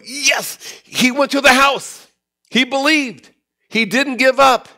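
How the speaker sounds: high, loud, fervent declaration; Jairus full of faith. Acted. Preacher voicing Jairus, bold and soaring. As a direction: ring it out like a man who won't quit.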